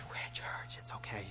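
soft unvoiced hush to a child; whisper. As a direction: hush them gently, it's church. Hushing children in church.

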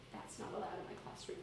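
low, very soft, almost whispered correction; walk up and say it gently under your breath; nice. Shown as a demonstration. Teacher quietly correcting a student, nice. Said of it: "it's just a kind of nice".

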